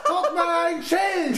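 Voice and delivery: high, laughing call; excited shout. Acted. Child calls friends to see a sign, excitedly. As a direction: point it out excitedly, half laughing.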